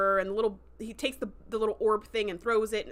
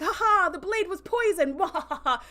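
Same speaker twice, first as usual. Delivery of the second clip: dying warrior's hammed-up laugh, louder and higher; mock laughing; 8 semitones higher, 5 dB louder than usual. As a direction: act out a big defiant laugh through the line. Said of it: "the brother's just laughing".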